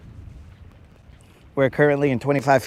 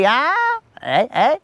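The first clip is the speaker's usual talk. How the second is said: mock cocky flirt; 9 semitones higher, slower than usual. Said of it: "they'd be like".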